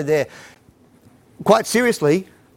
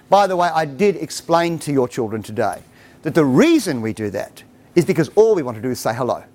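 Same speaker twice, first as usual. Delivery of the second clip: ordinary delivery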